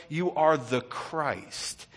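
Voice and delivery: drawn out, every word weighed; slow proclamation. Acted. Preacher voicing Peter's confession, slowly.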